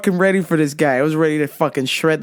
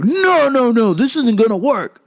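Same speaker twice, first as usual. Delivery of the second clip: loud, higher mock protest; irate customer; 6 semitones higher, 4 dB louder than usual. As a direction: refuse it like an exasperated customer. Acted customer refusing loudly.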